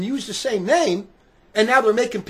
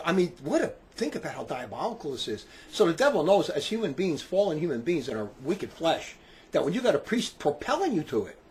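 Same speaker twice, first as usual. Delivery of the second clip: usual speaking voice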